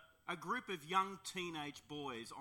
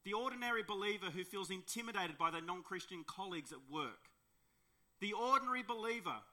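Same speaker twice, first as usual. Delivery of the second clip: ordinary delivery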